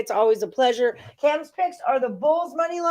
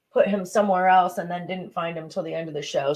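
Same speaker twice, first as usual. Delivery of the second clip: usual speaking voice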